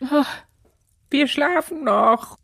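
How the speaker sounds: sleepy voice. Acted. Sleepy character answers knock.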